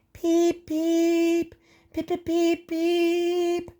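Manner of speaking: high shrieking whistle peeps; ghostly shriek. Acted. Percy scaring Thomas in the shed, shrieking whistle. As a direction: squeal like a ghost engine's whistle. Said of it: "he shrieked".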